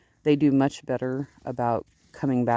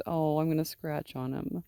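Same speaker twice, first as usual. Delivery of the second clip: normal talking voice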